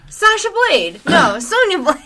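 high, loud startled double take; playful mock surprise; mock confused correction. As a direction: act baffled by the wrong name. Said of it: "I'm like".

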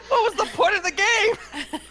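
high, wailing comic protest; hammed-up indignant cry; mock outrage. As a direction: whine it in exaggerated despair. Mock outrage at scoring twist.

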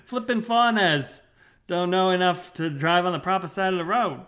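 high-pitched, indignant Aussie voice; Australian grumble. Acted. Local moaning about foreign drivers, indignant Australian accent. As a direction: gripe in a put-on Australian accent.

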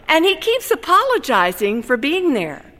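plain reading voice

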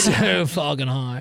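slow, dragged-out stoner voice; a hint of a lazy stoner. Shown as a demonstration. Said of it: "I just sounded like the biggest stoner".

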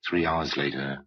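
usual speaking voice

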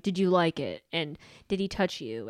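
low mock questioning; imitated nagging boyfriend. Boyfriend repeatedly questioning his girlfriend, imitated in a low voice. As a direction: lightly imitate him, dropping the pitch. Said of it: "continually asking questions. Like".

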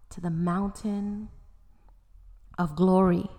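plain reading voice

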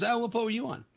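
high, light mock question; curious stranger. The stranger at the bar asks which boat, high and light. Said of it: "He goes".

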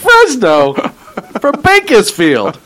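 slow, laughing mockery; laughing disbelief. Real, not acted. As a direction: scoff through a laugh. Mocking commute claim.

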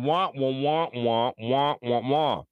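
Charlie Brown teacher trombone wah; muffled trumpet-like wah wah; muffled wah wah talk. Retold or imitated. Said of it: "sounds like the teacher in Charlie Brown".

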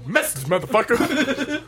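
very high, squeaky notification voice; high-pitched comic alert sound; mock alert voice. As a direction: play a cheeky ringtone in falsetto. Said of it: "make it go".

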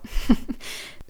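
brief breathy chuckle to oneself; a small laugh after a remark; short laugh. Real, not acted.

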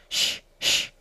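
two long shushes; shushing. A narrator's reading. Hatter and March Hare silencing Alice, shushing. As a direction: hush someone sharply, twice. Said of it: "the Hatter and the March Hare went".

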